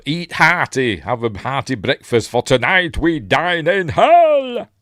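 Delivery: hammed-up battle speech, building to a loud, high, drawn-out finish; rally the troops, swelling to a roar on the last word; mock-heroic commander's bellow; rousing war cry. Retelling the Spartan leader's rousing speech.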